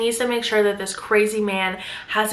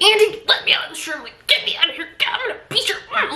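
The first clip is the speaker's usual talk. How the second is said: high, fast, half-laughing mock rage; freaking-out wife; 10 semitones higher, faster than usual. Trapped wife threatening her husband, fast and high. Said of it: "She's like".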